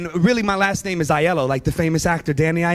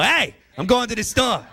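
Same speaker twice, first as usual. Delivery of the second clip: hey thrown out first, East Coast Italian; Italian hey up front; 3 semitones higher than usual. Retold or imitated. East Coast Italian hey up front, bragging to the crowd. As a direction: lead with a punchy Italian hey. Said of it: "We flip that. We go".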